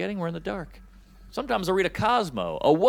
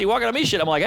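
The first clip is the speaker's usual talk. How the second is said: ordinary talk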